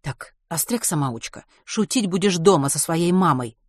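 usual speaking voice

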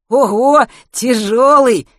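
loud happy gasp of discovery; joyful exclamation. A narrator's reading. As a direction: exclaim it with glee on finding the rod. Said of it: "радостно выдохнул Матвей".

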